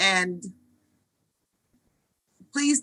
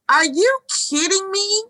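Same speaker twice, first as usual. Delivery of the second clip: incredulous; 10 semitones higher than usual. Acted. Incredulous exasperated question.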